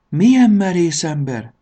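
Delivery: high, loud admiration; amazed exclamation. A narrator's reading. The checked suitcase admires the daring traveller, amazed exclamation. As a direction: cry out in wonder. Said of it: "csodálkozott kockás Koffer".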